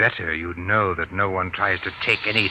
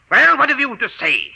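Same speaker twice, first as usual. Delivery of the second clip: sharp, high, louder demand; angry demand; 5 dB louder than usual. Acted. Harvey confronting his wife Linda about the calls, angry. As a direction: force her to answer.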